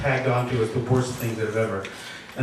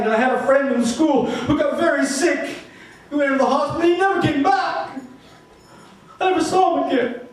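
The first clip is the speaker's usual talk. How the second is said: rising distress; 10 semitones higher than usual. Acted. Narrator recalling childhood losses, voice climbing in distress. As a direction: push the memories out as the pain builds. Grief-stricken memories rising.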